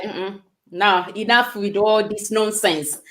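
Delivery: low, quiet, slow brush-off; dismissive. Retold or imitated. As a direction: wave it away firmly. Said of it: "I'm just like".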